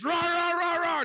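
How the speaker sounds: hammed-up mob shouting; mock angry mob. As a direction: yell like a crowd gone wild. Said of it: "You ever heard an angry mob before".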